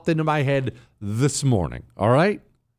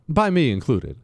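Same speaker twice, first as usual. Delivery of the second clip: usual speaking voice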